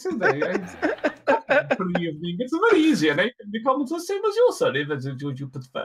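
high, teasing barmaid with a laugh in her voice; playful barmaid. Acted.

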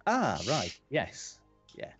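normal talking voice

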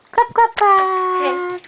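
slow sing-song. Real, not acted. Slow sing-song clap prompt.

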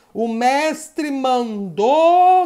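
very slow, stretched-out announcement; drawn-out game call. Pretend. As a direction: stretch each word to build suspense. Anuncia a ordem do jogo. (chamada de jogo bem arrastada)

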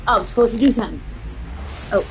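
ordinary delivery

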